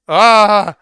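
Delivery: loud, high cry of pain; sudden loud yelp; pained scream. Acted. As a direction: scream as you get hit. Scream during the attack.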